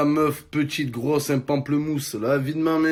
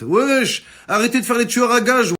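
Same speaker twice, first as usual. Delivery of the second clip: loud; 8 semitones higher, 5 dB louder than usual. Acted. Calling out at the bikers.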